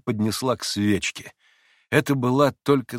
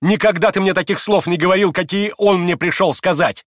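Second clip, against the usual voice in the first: high, loud indignant rebuke; heated, angry outcry; furious shout; 10 semitones higher, 7 dB louder than usual. A narrator's reading. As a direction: fling the reproach at him angrily. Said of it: "яростно крикнула ему Грушенька".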